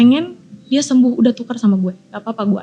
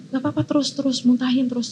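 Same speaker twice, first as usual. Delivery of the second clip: softer, gentle urging; soft coaxing; 7 dB quieter than usual. Retold or imitated. Facilitator quietly coaxing Dea to keep going, soft. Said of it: "dia terus bisikin gue".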